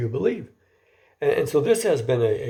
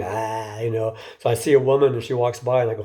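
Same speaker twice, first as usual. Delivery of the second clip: ordinary talk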